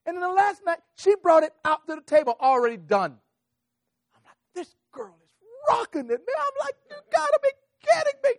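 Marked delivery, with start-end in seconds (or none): 0.1-3.2 very loud, high-pitched climax; building to a yelled punchline; amazed storytelling. Real, not acted. 4.5-8.3 high, shouted disbelief; hammed-up amazed yell; astonished outburst. Retelling his own astonished reaction.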